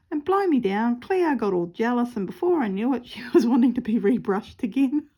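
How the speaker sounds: can't stop giggling as she tells it; amused retelling with a laugh in every word; laughing while telling. Real, not acted. Laughing, loud.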